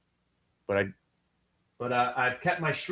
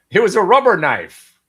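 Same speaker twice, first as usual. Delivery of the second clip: much louder, higher insistence; loud protest; 6 semitones higher, 11 dB louder than usual. Real, not acted. Streamer to TikTok, a loud defensive shout. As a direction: shout the excuse to the censors.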